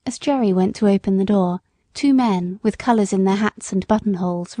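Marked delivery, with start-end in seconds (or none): none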